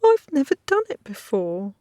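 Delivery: a lightly voiced girl sobbing it out; sobbing plea. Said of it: "Hinkle sobbed".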